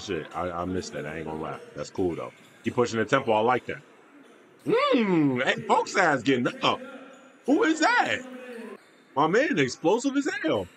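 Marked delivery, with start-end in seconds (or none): 4.7-10.7 high-pitched, swooping amazement; hyped and impressed, voice shooting way up; excited reaction. Real, not acted. Viewer reacting to an explosive player, excited.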